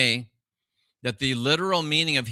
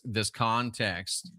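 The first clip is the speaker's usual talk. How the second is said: ordinary talk, nothing marked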